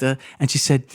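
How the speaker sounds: usual speaking voice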